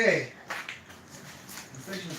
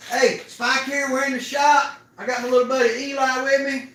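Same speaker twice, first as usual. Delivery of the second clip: upbeat, higher, slow greeting to viewers; vlog host intro; 6 semitones higher, 4 dB louder than usual. Acted. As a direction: welcome the viewers like a show host.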